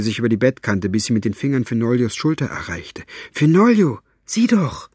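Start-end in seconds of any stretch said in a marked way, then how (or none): 3.3-4.9 high, louder excited call; urgent wake-up call. Acted. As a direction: rouse a sleeper to look, urgently. Shaking the sleeper awake.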